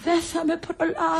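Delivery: very high, sustained invalid wail; hammed-up dying whine; feigned heart attack. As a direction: fake being stricken with pains. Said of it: "Έχω ανάγκη από μια καρδιακή κρίση".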